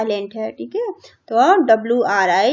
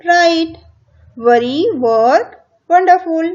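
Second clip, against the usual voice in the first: loud word drill; 4 semitones higher, 6 dB louder, much slower than usual. Shown as a demonstration. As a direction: read each word out clearly, louder than the explanation. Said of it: "ऐसे आपको इनको पढ़ना है". (ज़ोर से साफ़-साफ़ शब्द पढ़ना)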